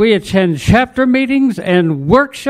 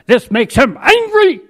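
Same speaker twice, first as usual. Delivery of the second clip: higher pitch, jumping up on "angry"; performed anger; 8 semitones higher than usual. Acted. Poet tells of a frustrated TV viewer, mock angry.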